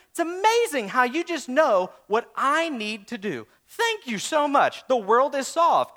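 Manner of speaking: sarcastic mock praise. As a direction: fake amazement at the easy answer. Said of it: "and I go".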